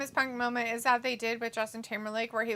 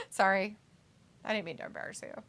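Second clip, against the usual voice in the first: ordinary talk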